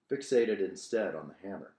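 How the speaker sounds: plain reading voice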